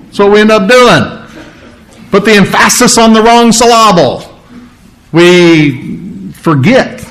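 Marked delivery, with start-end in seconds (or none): 2.1-4.2 higher-pitched, with "emphasis" as em-FASS-is and "syllable" as sil-LAH-bul; wrong-syllable stress. Shown as a demonstration. Preacher showing how people get it backwards, wrong-syllable stress on "emphasis" and "syllable". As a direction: punch the second syllable of "emphasis" and of "syllable".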